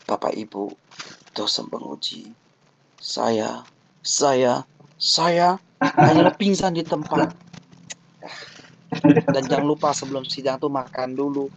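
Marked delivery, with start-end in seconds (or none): 0.1-7.3 low, halting voice that nearly gives out; faint, nervous student. Acted. Student addressing examiners before fainting, weak and halting. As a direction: falter between phrases as if about to faint. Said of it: "gaya tubuh harus relax. Jangan".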